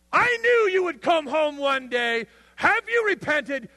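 high, loud, slow scolding father voice; shaming father. Retold or imitated. A harsh father shaming his returning son, high and slow. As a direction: wag the finger and shame him. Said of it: "where was his dad".

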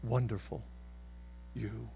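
low, soft-spoken praise. Acted. God praising the confessor in a low voice. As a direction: murmur it warmly, voiced. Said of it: "God looks at the confessor and says".

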